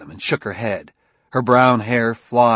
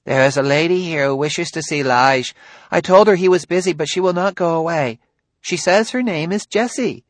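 high, fast, slurred robot voice; slurring voice; 7 semitones higher, 4 dB louder than usual. A narrator's reading. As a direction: slur it like a clunky robot. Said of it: "Sammy's slurring voice said".

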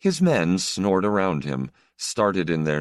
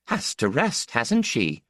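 higher midwife voice, mild contempt; scornful midwife; 8 semitones higher than usual. Acted. Midwife to the Count, mildly contemptuous, high. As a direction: toss it off as the impatient midwife. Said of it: "the midwife said with contempt".

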